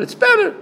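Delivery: BETTER lifted very high and loud; telling inflection. Shown as a demonstration. Rabbi hinting his ruling to questioner, telling inflection on BETTER. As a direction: let BETTER leap up in a high, emphatic pitch. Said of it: "hear the inflection of my voice".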